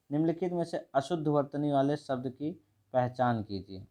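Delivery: normal talking voice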